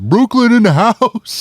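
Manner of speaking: loud, drawn-out hype call; hype shout. Retold or imitated. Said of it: "How often do you say that?".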